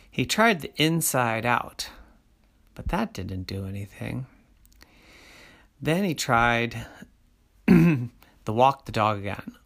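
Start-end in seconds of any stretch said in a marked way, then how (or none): none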